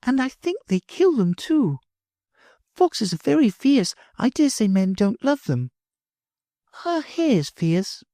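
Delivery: put-on a little girl hurrying off; high child's voice; little girl Sylvie. Sylvie questioning the narrator.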